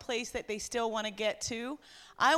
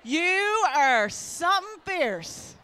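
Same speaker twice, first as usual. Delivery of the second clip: calling out; 5 semitones higher, 5 dB louder, slower than usual. Real, not acted. Calling out women by name.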